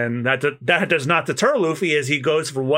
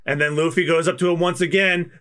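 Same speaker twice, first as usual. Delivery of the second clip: usual speaking voice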